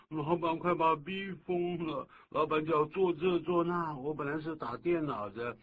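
high-pitched, loud complaint; hammed-up grievance, voice pushed up; exasperated employee. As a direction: play a put-upon worker griping about the boss. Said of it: "他说".

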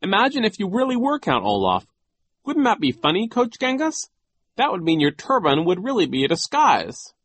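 high, loud, forced jollity; falsely merry boy, pitch lifted; feigned amusement. A narrator's reading. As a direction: play along as if joking. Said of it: "opened his mouth and laughed".

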